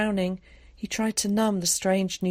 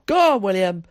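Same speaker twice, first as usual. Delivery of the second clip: loud shout of encouragement; 3 semitones higher, 9 dB louder than usual. A narrator's reading. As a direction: cheer the runner on at full voice. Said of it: "shouted Tom".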